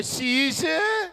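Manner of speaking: high, slow drawn-out call of the name शीशे; Punjabi aunty. Retold or imitated. Punjabi aunty calls the boy, high and drawn out. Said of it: "वो लोग पूरा नाम नहीं लेते".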